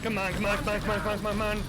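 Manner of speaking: loud, high pleading shouts; desperate yelling. Real, not acted. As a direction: beg it to work, yelling. Desperate urging before dying.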